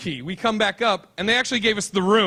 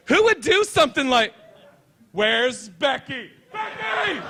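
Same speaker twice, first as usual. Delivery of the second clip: gag and shriek at the sight; disgusted girl; 8 semitones higher, slower than usual. Retold or imitated. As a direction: Lisa freaking out over the toilet, calling Becky. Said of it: "we hear Lisa just go".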